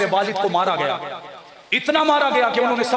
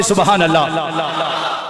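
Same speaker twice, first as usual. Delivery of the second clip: louder voice; 5 semitones lower, 4 dB louder, much slower than usual. Retold or imitated. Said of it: "बोले जरा बुलंद आवाज से".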